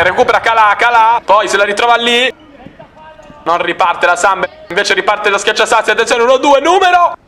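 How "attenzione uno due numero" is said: rising to a high excited call; excited commentary. Real, not acted. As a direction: voice climbs fast on the attack. Attack building toward goal.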